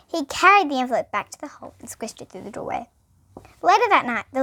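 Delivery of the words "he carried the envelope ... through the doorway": usual speaking voice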